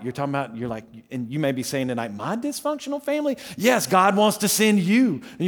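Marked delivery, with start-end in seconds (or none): 2.1-3.4 incredulous. Retold or imitated. Imitating listener's objection.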